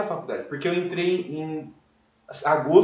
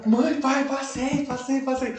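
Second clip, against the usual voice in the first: high, breathless cry of passing; excited announcement; 8 semitones higher, slower than usual. Retold or imitated. Telling his parents he got in, high and overjoyed. Tells parents he passed, crying.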